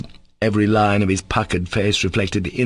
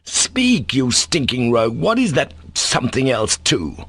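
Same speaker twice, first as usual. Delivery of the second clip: furious demand; 4 semitones higher than usual. Acted. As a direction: Speak flung out very high. Ivan demanding answer again.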